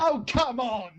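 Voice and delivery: high-pitched exasperated outcry; indignant protest. Real, not acted. Losing guesser to clue-giver, indignant. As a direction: object in playful disbelief at an unfair answer.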